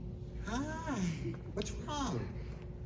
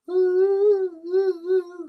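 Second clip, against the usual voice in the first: very high, loud, eerie "ooh"; spooky hypnotic ooh; 12 dB louder, much slower than usual. Acted. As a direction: hoot like a puppet casting a spell. Puppet pretends to hypnotize with eyes.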